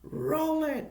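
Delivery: put-on high, cocky little-kid voice; cocky kid actor. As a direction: squeak it out like a brat who was born ready. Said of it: "Kevin Moore's like".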